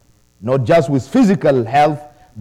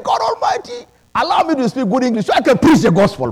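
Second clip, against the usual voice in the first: crying out to God; 9 semitones higher than usual. Retold or imitated. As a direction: plead upward with all your voice. Retells his prayer to God.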